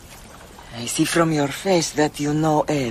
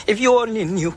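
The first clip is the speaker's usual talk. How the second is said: bitter laughing; 7 semitones higher, faster than usual. Acted. Laughing at her naivety.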